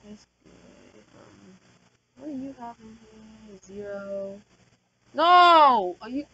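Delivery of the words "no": shouted no. Real, not acted. Dweller walks off.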